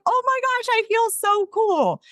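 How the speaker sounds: very high, gushing self-imitation; high-pitched mock gush, slowing; giddy delight. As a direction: squeal it like a thrilled fan, drawing out the end. Retold delight at being invited.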